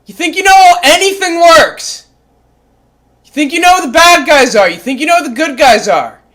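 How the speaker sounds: loud, high, scornful yelling; furious scornful rant; angry rant. Acted. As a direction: berate the audience.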